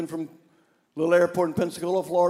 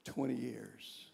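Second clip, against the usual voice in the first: choked voice; 4 semitones lower, 10 dB quieter than usual. Retold or imitated. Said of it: "In a choked voice".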